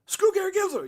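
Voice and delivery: high mock outburst; sudden outburst. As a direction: shout it out of nowhere. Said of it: "I say something like".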